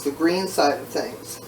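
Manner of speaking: normal talking voice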